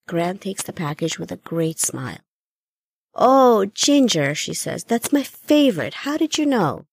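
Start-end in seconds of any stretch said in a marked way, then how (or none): 3.2-6.9 bright, raised delight at a favourite treat; light up with joy, voice lifting high; delighted grandmother. Acted. Old grandmother thanks Jenny for the candy, delighted.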